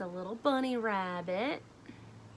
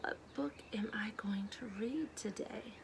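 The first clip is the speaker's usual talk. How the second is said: hushed playful question to the class; whispered question; 6 dB quieter than usual. Real, not acted. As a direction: whisper it like a guessing game. Teacher's teasing question to class.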